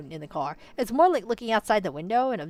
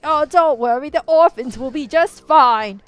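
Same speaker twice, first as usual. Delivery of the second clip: slow, higher, falsely sweet villain; a hammed-up fake reassurance; mock villain; 6 semitones higher, 9 dB louder, slower than usual. Mock villain reassures the protagonist, slow and sly. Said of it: "he's like".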